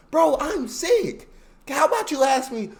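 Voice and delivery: loud, high exasperated outburst; drawn-out, indignant shout, played up; exasperated protest. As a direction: push back hard at an uncaring boss. Acting sick worker confronting boss.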